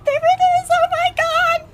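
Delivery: very high, loud squeal of delight; ecstatic squeal. Real, not acted. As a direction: shriek with joy at finding it. Spotting the Harry Potter entrance.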